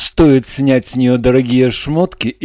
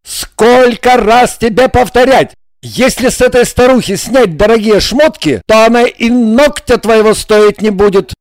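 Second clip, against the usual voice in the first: loud, higher exasperated yell; 8 semitones higher, 5 dB louder than usual. A narrator's reading. Igor yells at Regina in exasperation, loud and higher. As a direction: yell it out in frustration. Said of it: "завопил".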